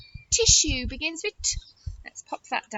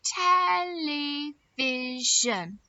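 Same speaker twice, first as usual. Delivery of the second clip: "Television" very slowly, each of four syllables drawn out; counted syllables; much slower than usual. Shown as a demonstration. Teacher counting syllables with children, "Television" slowly split up. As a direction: stretch "Television" into tel-e-vi-sion. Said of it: "Let's count how many syllables".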